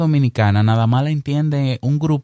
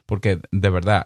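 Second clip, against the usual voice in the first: ordinary talk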